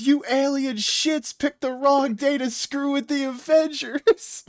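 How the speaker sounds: high, drawn-out mock battle cry; Hawkeye's battle threat. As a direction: play up the hero's defiant threat. Retold Hawkeye threat, much higher pitch.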